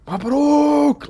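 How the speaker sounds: high, loud shout of congratulations; retold joyful scream. Friend screaming congratulations down the phone, loud joyful shout. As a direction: yell it down the phone with joy. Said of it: "Like, he's screaming".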